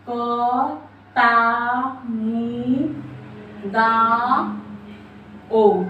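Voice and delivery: कतामुदाओ very slowly, each syllable drawn out and separated. Shown as a demonstration. Teacher breaking down a memory trick for students, slow syllable by syllable. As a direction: stretch out क, ता, मु, दा, ओ with pauses between.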